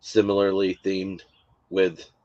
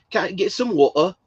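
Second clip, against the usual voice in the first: put-on high, raised Scottish kid's question with dropped T; put-on Scottish accent, pitched up; imitated Scottish accent; 10 semitones higher, 4 dB louder than usual. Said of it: "with this normal accent".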